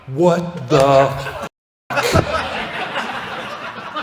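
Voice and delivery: slow, spaced-out mock astonishment; stunned out-loud disbelief. Moviegoer sees the villain unmasked, slow stunned disbelief. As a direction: draw out each word, dumbfounded. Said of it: "I verbally say out loud".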